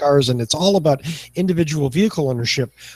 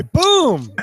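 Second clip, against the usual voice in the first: boom exclamation; 11 semitones higher, 3 dB louder than usual. Real, not acted. Punctuating the other's comeback.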